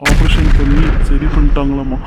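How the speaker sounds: loud, bursting with relief; joyful news. Acted. Son telling his mother the surgery went well, loud and overjoyed. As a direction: shout it out with joy.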